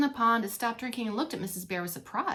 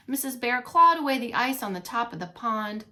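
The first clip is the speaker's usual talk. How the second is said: ordinary delivery, nothing marked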